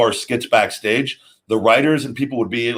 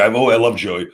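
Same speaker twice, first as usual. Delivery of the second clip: ordinary talk, nothing marked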